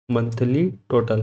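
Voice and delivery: "Monthly total" said slowly with stress on "monthly"; "monthly" leaned on, the whole phrase drawn out; slow dictation. Shown as a demonstration. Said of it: "एम ओ एन टी एच एल वाई".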